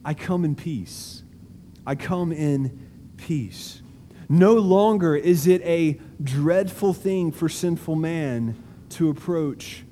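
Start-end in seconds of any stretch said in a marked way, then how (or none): 0.0-3.8 soft reassurance. Acted. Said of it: "and he says".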